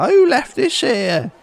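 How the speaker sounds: put-on grumpy old locals. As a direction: play a fussy old villager.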